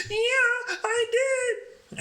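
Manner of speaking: high mock whimper; high drunk girl voice. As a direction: imitate her tearful yes.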